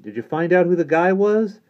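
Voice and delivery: high, loud, excited question; eager boy asking. Acted. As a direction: press for news in a boyish pitch. Boy eagerly questions returning priest.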